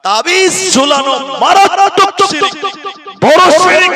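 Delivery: high, loud, slow denunciation; full-force preaching; fiery condemnation. Acted. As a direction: thunder it from the pulpit.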